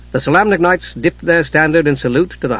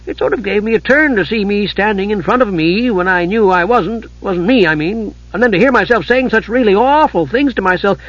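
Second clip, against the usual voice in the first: high, rambling, flustered chatterbox; high-pitched, naive storyteller babbling through a scare; chattering Tasselhoff character voice; 6 semitones higher than usual. Acted. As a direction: prattle on indignantly in a high voice.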